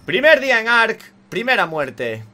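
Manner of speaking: high, loud proclamation; mock announcement. Real, not acted. Gamer to viewers after first death, mock announcement.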